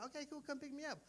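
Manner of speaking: put-on high, soft girlish reply; sweet phone-call answer; mock girl voice. Date agrees to be picked up, soft girlish voice. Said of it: "girl on phone voiced".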